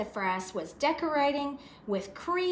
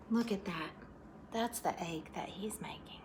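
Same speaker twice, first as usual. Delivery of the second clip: whisper; 3 semitones lower, 12 dB quieter than usual. Real, not acted. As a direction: whisper it like a secret. Aside to listening children.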